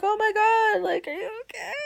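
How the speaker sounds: high-pitched imitation of a concerned coworker; mock-alarmed, high concerned question. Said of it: "And she's like".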